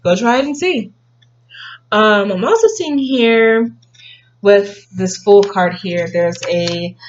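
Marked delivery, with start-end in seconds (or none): 0.0-0.9 lightly higher, offhand shrug; noncommittal, let them find out; shrugging grandparent. Retold or imitated. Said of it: "they're like".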